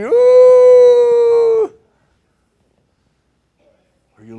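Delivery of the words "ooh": high, loud mock-sentimental coo; mock swoon. Preacher mocking tears over [name] songs, high cooing swoon.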